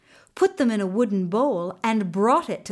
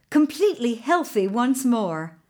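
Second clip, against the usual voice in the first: ordinary delivery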